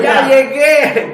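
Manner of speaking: high, loud, drawn-out call with a laugh; joyful shout. Retold or imitated. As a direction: announce your arrival in heaven at full voice. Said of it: "y gritando". (grito alegre, agudo y fuerte, alargado)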